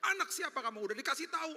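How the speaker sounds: high, nagging parent; mock scolding. A hint of a father scolding his kids. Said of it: "nggak pernah mau gini-gini mereka".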